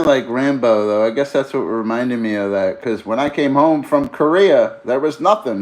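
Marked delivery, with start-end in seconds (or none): 2.8-5.6 higher, louder veteran's lament; mock movie monologue line; movie quote. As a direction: recite it like the bitter vet. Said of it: "That was the same with Teasel".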